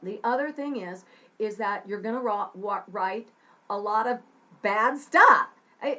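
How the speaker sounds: usual speaking voice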